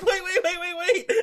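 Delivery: very high, whimpering string of waits; mock panicked plea. Sugar stops the returning zombies, mock-panicked squeal. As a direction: stammer for mercy in a squeal.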